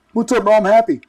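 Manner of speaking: mock a goofy overeager guy; goofy dude. A stranger greets the speaker, imitated goofy and cheerful.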